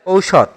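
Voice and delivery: ঔষধ said as "OU-shodh"; model pronunciation. Shown as a demonstration. Teacher gives pupils the answer for ঔষধ, model pronunciation with "ou". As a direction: open ঔষধ on a clear "ou" glide. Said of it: "ঔষধ শব্দের সঠিক উচ্চারণ হচ্ছে".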